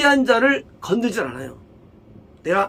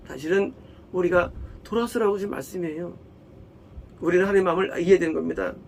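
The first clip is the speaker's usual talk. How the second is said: ordinary talk, nothing marked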